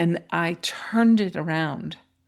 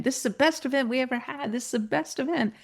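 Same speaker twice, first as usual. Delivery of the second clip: high, quick chatter; repeated enthusiasm; 6 semitones higher, faster than usual. Retold or imitated. Staff at Bob's event keep saying it, lightly excited. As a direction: imitate people repeating it over and over, quickly. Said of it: "they kept saying over and over again".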